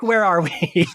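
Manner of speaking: ordinary talk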